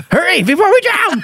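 high laughing plea; laughing mock panic. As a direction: laugh through a fake urgent plea.

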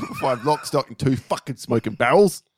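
laughing mock London accent; shithouse English accent. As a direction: lean hard into a bad English accent. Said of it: "in an English shithouse accent".

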